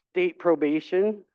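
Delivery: ordinary delivery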